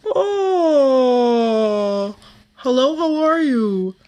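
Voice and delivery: high, slow, lifted voice round a spoon on the tongue; speaking with a spoon. Shown as a demonstration. Voice coach showing spoon exercise, high and slow. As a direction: greet with a spoon pressing the tongue. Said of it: "help you feel the cool breath".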